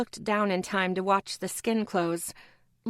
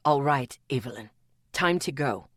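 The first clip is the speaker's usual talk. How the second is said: deep man's voice, calm and firm; low male voice declaring it; low firm announcing; 7 semitones lower than usual. A narrator's reading. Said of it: "Atticus announced".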